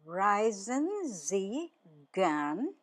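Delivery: "Reisen Sie gern" drawn out slowly, pause before "gern"; slow word-by-word model question; slow teaching repeat. Shown as a demonstration. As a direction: stretch each word for learners. Teacher repeats phrase slowly for learners.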